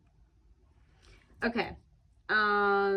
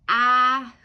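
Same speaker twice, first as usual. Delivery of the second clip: loud, fuller "ah", moderately raised pitch; loud scream; 5 semitones higher, 12 dB louder than usual. Shown as a demonstration. Showing how they would scream, loud passionate shout. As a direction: belt out a passionate "ah". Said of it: "really loudly".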